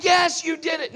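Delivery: loud, high praise; proud cheer. Retold or imitated. Father praises daughter learning to ride, loud cheer. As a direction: cheer her on loudly. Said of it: "I'm like".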